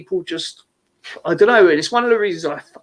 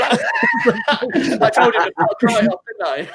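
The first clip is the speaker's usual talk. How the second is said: laughing while telling; 7 semitones higher, much slower than usual. Real, not acted. Laughing while retelling the row.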